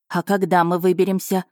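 plain reading voice

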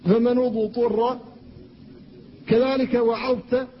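"وعظت" slowly, with the ظ sounded before the ت; clear letter. Shown as a demonstration. Teacher shows the correct "وعظت". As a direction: let the ظ of "وعظت" ring before ت. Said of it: "إظهار الضاء".

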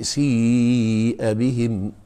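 سيء بهم drawn out very slowly, chanted; Quran recitation. Shown as a demonstration. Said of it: "لو انت بقى قاريه بقى".

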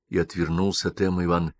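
plain reading voice

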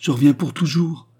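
André crying out her joy to her brother; joyful cry. A narrator's reading. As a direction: cry it out in pure delight. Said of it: "un transport de bonheur qui fit de son exclamation un cri éclatant".

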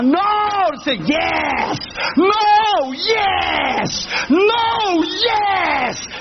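high, drawn-out cries back and forth; acted shouting match. Preacher and frightened passenger arguing, hammed-up shouting. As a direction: wail each refusal and insistence theatrically.

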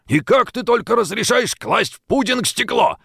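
high, indignant scolding; angry rebuke. A narrator's reading. Colonel scolds his wife over the pudding, angry. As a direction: tear into her over the glass. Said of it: "прорычал он".

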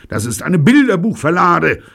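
loud, high-pitched outraged yell; angry shout. A narrator's reading. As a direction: cry out at being set up. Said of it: "schreit Steve".

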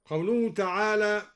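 normal talking voice

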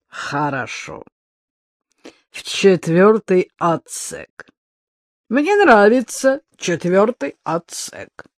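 slow, unpleasant gloating. A narrator's reading. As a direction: savor the words with nasty pleasure. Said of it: "Он засмеялся неприятным, булькающим смехом".

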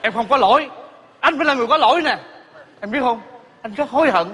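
high, urgent confession; remorseful pleading. Acted. Husband confesses to his estranged wife, high urgent pleading.

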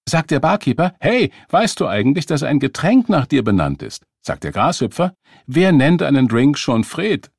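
loud, high joke-telling voice; telling a dad joke. Acted. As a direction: punch it like a bar joke. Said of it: "Randy erzählte einen Witz".

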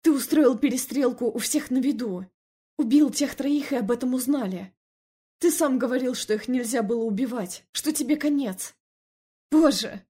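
loud, high-pitched accusation; angered. A narrator's reading. Wife berating her husband over the shootout, angry. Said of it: "разозлилась я".

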